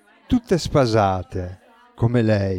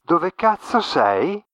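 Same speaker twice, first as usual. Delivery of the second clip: distorted voice; 7 semitones higher, slower than usual. A narrator's reading. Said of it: "Una voce distorta rispose".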